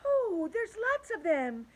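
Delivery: high, slow alarmed cry; cried out. A narrator's reading. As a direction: call out in growing alarm. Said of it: "cried Ladybug".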